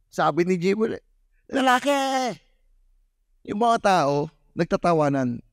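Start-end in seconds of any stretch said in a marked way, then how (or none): 1.5-1.9 put-on child's shout. Son Jiboy shouting his answer, very high. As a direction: blurt it out eagerly.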